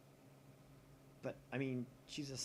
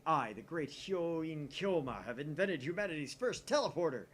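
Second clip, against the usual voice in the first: louder and higher, slow on his title; grandiose boast; 5 semitones higher, 9 dB louder than usual. Acted. Claiming the invention before his rival, a grand loud boast. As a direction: announce your own greatness to the world.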